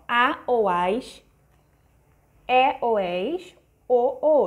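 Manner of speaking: each ending drawn out slowly for the class; slow classroom sounding-out of the endings; spelling out word endings. Shown as a demonstration. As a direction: say A, as, é, és, o slowly and clearly like a teacher. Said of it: "Ó, palavras terminadas em A".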